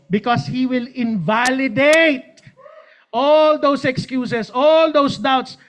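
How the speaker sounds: loud, slow, drawn-out all those; preaching emphasis. Real, not acted. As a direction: hammer the point home. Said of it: "preaching emphasis".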